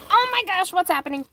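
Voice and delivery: sudden high startled gasp; surprised. Real, not acted. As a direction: caught off guard, voice jumps up. Startled by something mid-move.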